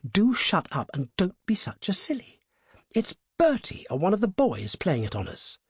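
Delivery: lightly voiced critical young woman; cool, critical young woman. A narrator's reading. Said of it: "The other was cooler and more critical".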